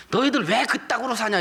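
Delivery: high, scornful jab at church people; scornful jeer. Retold or imitated. Unbelievers scorning church members, high and jeering. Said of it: "불신자들이 성도들 보고".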